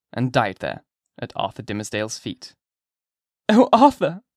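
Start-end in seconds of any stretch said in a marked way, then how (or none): 3.5-4.2 high, loud anguished cry; cried out. A narrator's reading. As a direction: call his name in desperate anguish. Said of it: "cried she".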